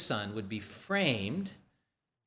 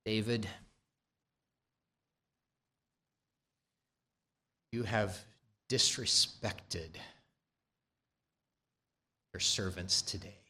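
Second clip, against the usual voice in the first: quiet rebuke; 4 semitones lower, 5 dB quieter than usual. Acted. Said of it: "Joab came with a rebuke".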